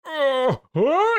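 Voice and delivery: high, rising to a loud wail; squeaky awestruck moans; high awed whimper. Acted. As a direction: overcome by the light, voice climbing. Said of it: "he goes".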